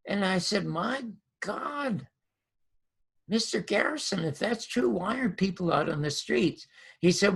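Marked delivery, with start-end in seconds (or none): 0.7-6.7 softer, higher retold amazement; taken aback. Young host hearing poll results from Garrison, taken aback. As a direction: retell it in hushed, high disbelief.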